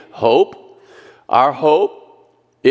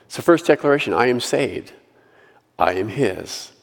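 ordinary talk, nothing marked

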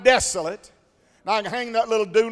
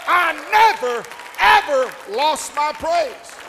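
quoting the hymn chorus; 5 semitones higher, 4 dB louder than usual. Retold or imitated. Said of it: "she gets to the chorus".

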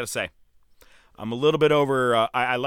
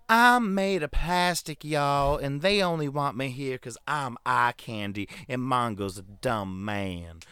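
mock Southern belle; slower than usual. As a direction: parody Deborah's fake sweetness, slowly. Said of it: "Or it could have been".

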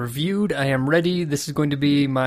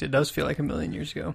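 whisper; 6 dB quieter, faster than usual. Real, not acted.

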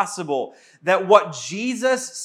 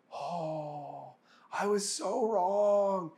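softer, slower, hushed with shame; ashamed hush; 9 dB quieter, much slower than usual. Retold or imitated. Thomas seeing risen Jesus, hushed slow shame. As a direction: let it fall out quietly in shame.

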